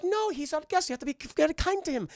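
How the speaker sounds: high cartoon old-lady voice; protesting Muriel impression. Muriel defending guest to mob, protesting.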